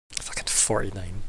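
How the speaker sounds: whispered swear under breath; whispered curse. Real, not acted. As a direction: hiss the number under your breath. Muttering a bad roll.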